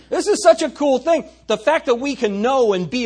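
bursting high and loud; excited. Real, not acted. Pastor rousing a flat congregation, high and excited. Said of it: "You guys aren't nearly as excited about this as I am".

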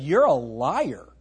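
slow, pointed you're a liar; slow blunt accusation. Retold or imitated. Pastor confronting the man, slow and pointed. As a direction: spell it out to the man's face.

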